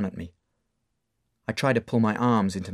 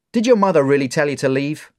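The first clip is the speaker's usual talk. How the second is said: louder doubting question; bar owner; 5 semitones higher, 7 dB louder than usual. Acted. Mark doubting Dave's story: loud probing. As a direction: press him.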